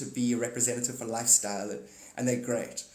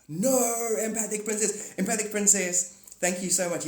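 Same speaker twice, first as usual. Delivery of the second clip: gasping, higher, gushing thanks; gushing mock fan; 7 semitones higher, 4 dB louder than usual. Fan thanking the empathic princess, gasping and gushing higher. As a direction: overdo the starstruck fan.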